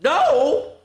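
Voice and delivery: firm loud high no; the book shouts back no; comic booming refusal. Acted. Book answers her plea.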